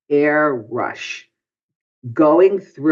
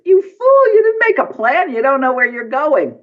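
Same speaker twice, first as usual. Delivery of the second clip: high, loud cry of self-reproach; scolding himself; 12 semitones higher, 4 dB louder than usual. Retold or imitated. Man out of his body scolds himself, high loud cry. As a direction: shriek at yourself like a panicked fool.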